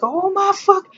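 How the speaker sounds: high, pained outburst; exasperated cry. Retold or imitated. Woman in labor crying out in pain, high and exasperated. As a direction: cry out in agony. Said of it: "I was like".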